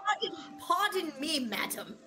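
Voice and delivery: flustered apology. Acted. Character apologizing, high voice.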